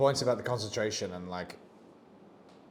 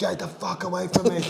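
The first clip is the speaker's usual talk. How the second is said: louder, higher furious shout; angry yell; 7 semitones higher, 6 dB louder than usual. Retold or imitated. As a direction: drive him off with a yell. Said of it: "He's like".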